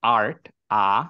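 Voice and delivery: আর্ট said slowly, then আ held alone as the open a of art; a teacher isolating আ from আর্ট, slowly; vowel sound demo. Shown as a demonstration. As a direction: stretch আর্ট and pull out its আ. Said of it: "একটার উচ্চারণ করলাম অ্যাপল".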